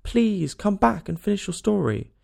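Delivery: high-pitched entreating call; calling after. A narrator's reading. Alice calls after the departing mouse, entreating. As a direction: call them back, voice lifted. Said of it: "Alice called after it".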